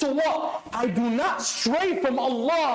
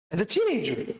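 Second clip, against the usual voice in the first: ordinary talk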